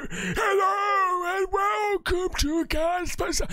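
high, slow, drawn-out wailing ghost. Pretend. Host mocking how a ghost would talk, wailing ghost voice. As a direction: wail it out like a spooky mock ghost. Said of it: "I need to talk like".

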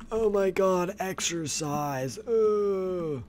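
high, drawn-out whine of complaint; mock exhausted groan. Player moaning about all the exercise, mock exhausted groan.

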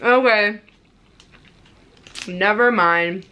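talking between bites; chewing while talking. Real, not acted. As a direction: mouth partly full. Seen 3: Bit bar at -7; chewing lips and hand-covered mouth seen throughout.

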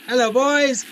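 high, loud teasing hello; cheeky taunt. Pretend. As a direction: taunt the enemies. Taunting enemies.

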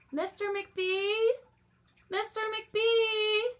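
high, loud, slow calling; long drawn-out call for help. Acted. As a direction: call him from far down the hall. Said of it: "she called after a minute".

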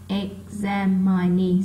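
EXAMINE slowed and split into separate syllables. Shown as a demonstration. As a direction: draw out "examine" so each syllable can be counted. Tách âm để đếm trọng âm. (đọc chậm, tách từng âm tiết "examine")

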